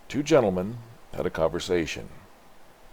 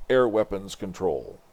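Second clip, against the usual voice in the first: plain reading voice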